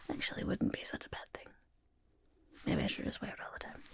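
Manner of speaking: quick, hushed thinking aloud; muttered aside. Pretend. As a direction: drop to a fast, very soft mutter to yourself. Muses aside to self about gear.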